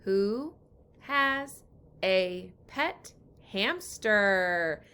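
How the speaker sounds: put-on slow, word-by-word reading along with children; teacher reading along with kids; slow choral reading. Shown as a demonstration. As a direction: read slowly with the class. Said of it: "Ready, read".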